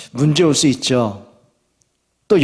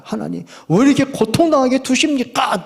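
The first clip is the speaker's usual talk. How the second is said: higher-pitched acted protest; challenging God; 10 semitones higher than usual. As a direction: plead accusingly, as if demanding an answer. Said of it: "이렇게 따지는 거죠". (따져 묻는 말투)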